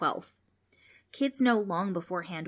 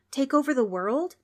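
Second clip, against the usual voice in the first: much higher, louder disbelieving question; a girl stunned by the plan; shocked kid voice; incredulous girl; 8 semitones higher, 6 dB louder than usual. Acted. Trix incredulous.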